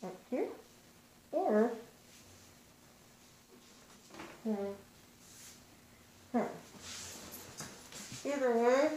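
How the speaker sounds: muffled behind cloth mask; speaking with mouth covered; through a face mask. Real, not acted. Seen 1: Cloth mask covers mouth and nose while tying it behind head.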